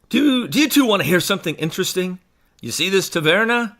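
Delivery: a hint of dude, quick and casual. Actor saying his line without the accent, mildly like a dude. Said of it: "say it like a dude".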